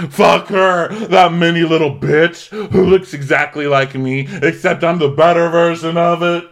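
high, loud hallway yell; hammed-up furious rant; mock screaming. Emily screaming down the hallway, high and loud. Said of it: "now screaming down the hallway".

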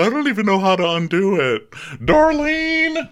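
high, helpless mock voice; hammed-up high-pitched helplessness; acted-out housewife. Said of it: "She's like".

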